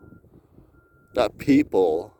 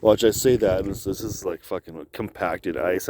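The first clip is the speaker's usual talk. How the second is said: normal talking voice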